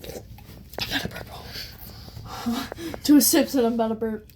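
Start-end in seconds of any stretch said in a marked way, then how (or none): 0.0-3.0 soft whisper into a breathy laugh. Real, not acted. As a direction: hush it, burp is coming. Fighting the urge to burp.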